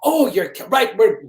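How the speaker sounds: put-on high, loud, botched Welsh accent; a bad stab at a Welsh accent; attempted Welsh accent. Police officer answering a neighbour's report, failed Welsh accent. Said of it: "I can't do the Welsh accent".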